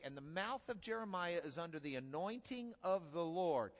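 ordinary talk, nothing marked

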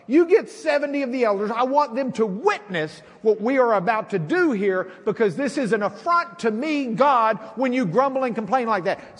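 God fed up with grumbling; voice of an angry God. Acted. God voiced in Bible story.

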